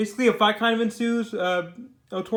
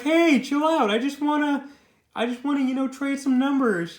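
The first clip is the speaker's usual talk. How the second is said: higher-pitched mock "chill out" plea; acted casual coaxing, pitched up; placating; 6 semitones higher, 5 dB louder than usual. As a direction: play the flirt talking her down. Acted flirt placating the angry woman.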